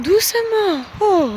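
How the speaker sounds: calling to horse. Real, not acted. Calming horse on the lunge.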